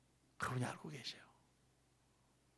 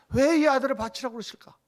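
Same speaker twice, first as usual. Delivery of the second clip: loud rhetorical cry; 7 semitones higher, 8 dB louder than usual. Acted. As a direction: raise the voice high and loud on the question. Preacher asks loudly. (높고 크게 외치는 질문)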